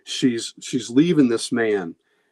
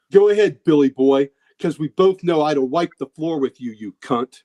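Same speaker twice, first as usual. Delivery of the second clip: higher, louder mock dare; goading shout; 5 semitones higher, 3 dB louder than usual. Father daring his son Billy to hit him, loud mock shout. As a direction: bellow the dare, then sneer it down.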